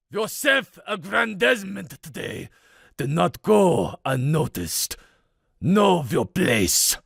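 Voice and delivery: put-on stern Russian accent, slow at the end; heavy Russian accent, commanding. A narrator's reading. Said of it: "Dmitri's voice was deep, tinged with a thick Russian accent".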